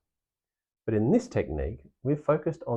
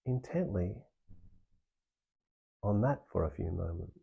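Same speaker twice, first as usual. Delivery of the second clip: softer, unhurried meditation guide with long pauses; guided meditation; 4 dB quieter than usual. Acted. Meditation teacher guiding listeners through practice, soft and calm. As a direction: ease the listener inward, gently.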